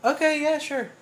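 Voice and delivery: squeaky, high agreement; high, squeaked okay; high-pitched concession. Real, not acted. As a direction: give in with the voice way up high. Gives in on the ranking.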